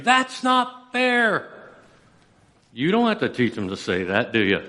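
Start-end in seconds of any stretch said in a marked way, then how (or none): 0.0-1.5 mock kid's yell. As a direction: lightly imitate a kid's indignant whine, slow and high. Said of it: "a kid scream".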